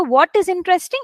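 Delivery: usual speaking voice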